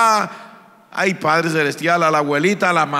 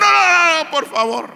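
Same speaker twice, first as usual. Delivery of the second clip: terrified pleading; 10 semitones higher than usual. Acted. Terrified relative begs the risen man.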